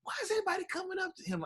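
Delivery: high, soft, fast puzzled question; a quick high whisper of confusion; imitating wife. Wife leaning in to ask about the crowd, high and fast.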